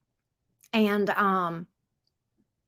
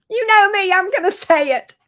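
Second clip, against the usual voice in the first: excited; 8 semitones higher, 7 dB louder than usual. Real, not acted. Playful boast to chat.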